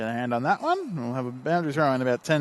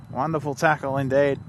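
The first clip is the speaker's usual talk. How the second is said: normal talking voice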